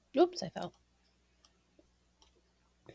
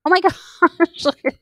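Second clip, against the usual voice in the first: high, slow laughing oh my gosh; 9 semitones higher, 8 dB louder than usual. Real, not acted. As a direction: laugh through the words. Laughing at the fallen dummy.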